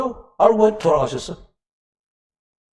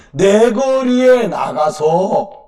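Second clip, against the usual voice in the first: very slow emphatic; 3 dB louder, much slower than usual. Shown as a demonstration.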